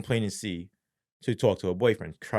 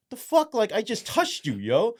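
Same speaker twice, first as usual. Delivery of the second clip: retold disbelief; 10 semitones higher than usual. Officer to the man who fell at a light check, incredulous. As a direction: pitch leaps up, incredulous.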